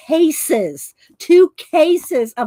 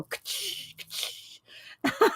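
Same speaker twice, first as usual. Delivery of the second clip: whispered punch noise; 8 semitones higher, 10 dB quieter, much slower than usual. Retold or imitated. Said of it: "but we all go".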